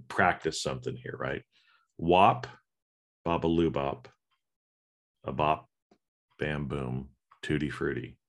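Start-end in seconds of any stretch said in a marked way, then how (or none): 2.0-8.2 lifeless reading with long pauses; depressing deadpan. Shown as a demonstration. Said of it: "kind of depressing in the way that I said it".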